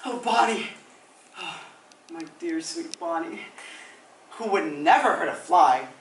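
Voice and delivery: swooping, theatrical lament; melodramatic stage diva. Acted. Actor abandoned by Bonnie, overwrought comic lament. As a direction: lament grandly, surging and sinking with each phrase.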